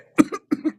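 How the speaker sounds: hard cough from choking on water. Real, not acted. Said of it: "Water went down the wrong way".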